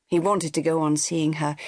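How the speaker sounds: ordinary delivery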